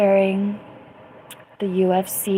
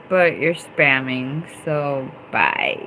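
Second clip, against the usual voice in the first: dismissive; 6 semitones lower, slower than usual. Real, not acted. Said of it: "I indulged you".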